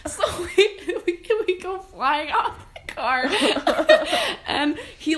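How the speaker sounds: high, loud, helpless laughter through the words; laughing. Real, not acted. Telling a friend about flying off a car, laughing. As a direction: barely get the story out for laughing. Laughing at own accident story.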